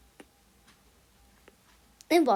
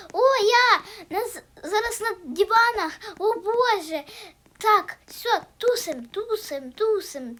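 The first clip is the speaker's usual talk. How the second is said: higher, louder excited cries; 7 semitones higher, 7 dB louder than usual. Real, not acted. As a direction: bubble over with joy. Радіє в грі. (радісні вигуки)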